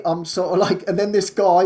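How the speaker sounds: ordinary talk, nothing marked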